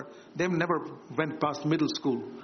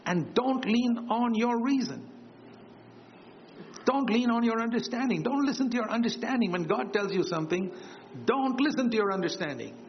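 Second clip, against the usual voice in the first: fervent preaching; 8 semitones higher than usual. Acted. Raised preaching on Proverbs.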